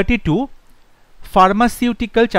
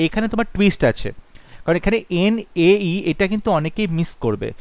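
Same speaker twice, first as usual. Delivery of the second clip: ordinary delivery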